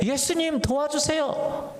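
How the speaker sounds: high, slow plea to Jesus; a drawn-out plea for rescue from the storm; disciples crying out. Retold or imitated. Disciples in the storm begging Jesus, slow high plea. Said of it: "라고 말한 거예요".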